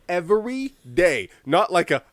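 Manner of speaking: normal talking voice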